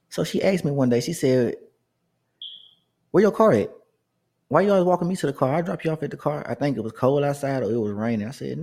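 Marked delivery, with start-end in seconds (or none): 3.1-6.5 woman's voice, much higher and louder, quick; imitating the older woman. Older woman coworker insists on driving him home, high pushy voice.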